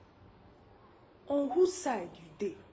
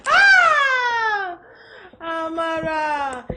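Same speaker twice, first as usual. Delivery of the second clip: long, high, loud wail of the name; exclaiming wail; 7 semitones higher, 10 dB louder, much slower than usual. Acted. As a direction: cry out in drawn-out protest. Wife exclaims at friend's advice.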